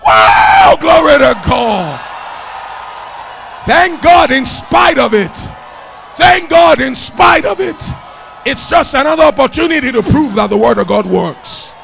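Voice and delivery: loud, exultant preacher's shout; full-force preaching. Real, not acted. Preacher urging thanks in spite of trouble, full-force shouting. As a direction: shout the exhortation to the crowd.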